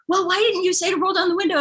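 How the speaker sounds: high, fast exasperated imitation; upset driver. Driver asking why not the window, high mock upset. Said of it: "the driver just was very upset".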